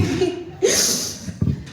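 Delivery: a soft, airless laugh through tears; a real emotional laugh; tearful breathy laugh. Real, not acted. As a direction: laugh to cover crying. Overcome with emotion at podium.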